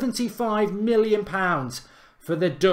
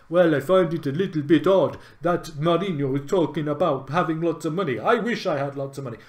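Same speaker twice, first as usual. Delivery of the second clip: lightly put-on bad French accent; mock a French manager in a rubbish French accent, half-hearted; a hint of a clumsy French accent, barely kept up; rubbish Wenger French accent. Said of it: "Rubbish French accent".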